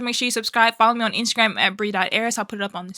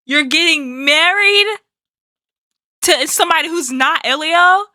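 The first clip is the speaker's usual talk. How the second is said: shocked disbelief; 4 semitones higher, 4 dB louder than usual. Real, not acted. Reactor hearing of Oliver's wedding, shocked disbelief. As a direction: protest the news in disbelief, pitch rising at the end.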